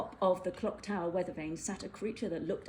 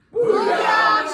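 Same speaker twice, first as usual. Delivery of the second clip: a very loud, much higher shouted squawk; shouted bird cry; 15 dB louder than usual. Acted. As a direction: blast it out like a gloating bird. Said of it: "shouted the bird".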